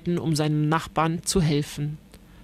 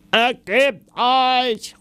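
slow, high, drawn-out demand; enemy knight calling; 5 semitones higher, 4 dB louder, much slower than usual. A narrator's reading. Ritter Blaubart calls up to the castle demanding surrender, slow loud call. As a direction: demand surrender from below the walls. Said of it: "ruft Ritter Blaubart".

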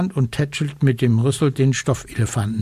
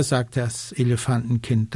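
ordinary delivery, nothing marked

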